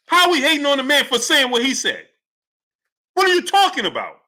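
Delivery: high-pitched, exasperated challenge; indignant rant. Real, not acted. Host arguing with critics in the chat, indignant. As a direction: fire back in real disbelief.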